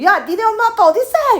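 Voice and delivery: high, loud barked demand; threatening soldier. Retold or imitated. Enemy soldier to the girl, a loud barked demand. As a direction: menace the child.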